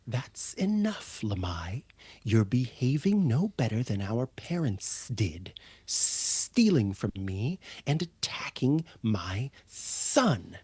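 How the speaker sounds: firm hushed rebuke; stern whisper. Acted. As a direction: scold in a whisper, never raise the voice. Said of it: "the mother kobold, who says".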